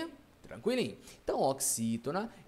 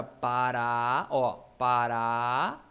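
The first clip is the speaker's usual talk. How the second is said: "pará" with a long, loud last "á"; stressed final syllable; 6 semitones lower, 5 dB louder, much slower than usual. Shown as a demonstration. Teacher shows which syllable is stronger, stressed ending. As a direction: stretch and punch the "rá" in "pará". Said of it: "o que é mais forte".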